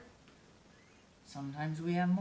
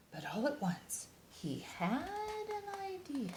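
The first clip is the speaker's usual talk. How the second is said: lively picture-book read-aloud; reading to children; 3 semitones higher, 5 dB louder than usual. A narrator's reading. Reading a bear picture book to young listeners, animated storytime voice. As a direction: read it like storytime for little kids.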